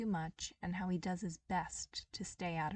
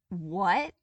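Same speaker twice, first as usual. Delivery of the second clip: loud, rising disbelieving what; stunned by what she just heard; loud shocked what; 11 dB louder than usual. Acted. Toph shocked by assassination news.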